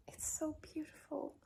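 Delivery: breathy awed whisper; hushed whispered admiration. Real, not acted. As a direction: whisper it in awe of the place. Admiring the apartment softly.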